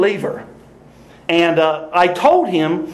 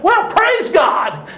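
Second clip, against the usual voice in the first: high, very slow praise God; mock-joyful praise; 11 semitones higher, much slower than usual. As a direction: draw it out with delight. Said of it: "I'm like".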